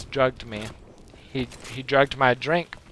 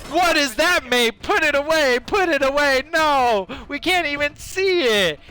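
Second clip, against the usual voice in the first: very high, mock-horrified outcry; hammed-up high-pitched protest; appalled onlookers; 10 semitones higher, 3 dB louder than usual. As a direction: squeal in comic disgust. Onlookers recoil at what he exposed.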